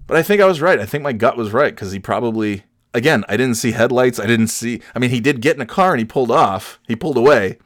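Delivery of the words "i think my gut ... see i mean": ordinary delivery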